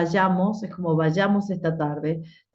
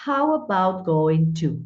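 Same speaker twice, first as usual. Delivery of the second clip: ordinary delivery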